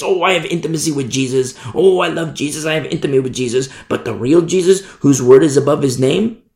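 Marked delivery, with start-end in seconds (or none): 0.0-3.7 fast, dismissive mock; mock claim. Fast mock of false believers insisting on intimacy. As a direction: rattle it off like empty words. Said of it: "they can say it to their blue in the face".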